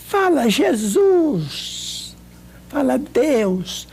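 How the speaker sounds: pleading slowly with a sick friend; slow coaxing. Retold or imitated. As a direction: stretch each word to help her repeat it. Coaxing the dying friend again.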